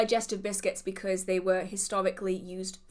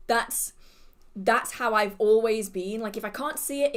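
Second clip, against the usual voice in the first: usual speaking voice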